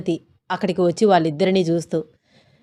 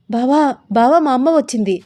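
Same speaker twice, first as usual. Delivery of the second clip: higher-pitched, urgent warning; startled alarm; 3 semitones higher than usual. A narrator's reading. Wife warning her husband that her mother has come, startled. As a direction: warn him, caught off guard. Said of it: "ఒక్కసారిగా ఇద్దరు ఉలిక్కిపడ్డారు".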